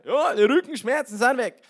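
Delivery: high, very loud imitated local; shouting Bavarian man. Healed Bavarian calling back to preacher, loud shout. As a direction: holler it back in broad Bavarian.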